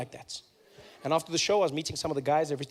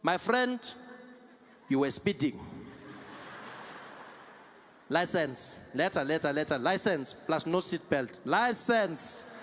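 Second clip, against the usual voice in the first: put-on South African metro cop accent; South African policeman; slower than usual. Metro policeman stopping a driver, South African accent. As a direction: play a South African traffic officer. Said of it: "the Metro Police comes there".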